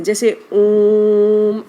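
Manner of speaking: Om held long and slow; drawn-out Om. Shown as a demonstration. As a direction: stretch the Om out like a chant. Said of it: "आप इसको कितना भी लंबा उच्चारित कर सकते हैं".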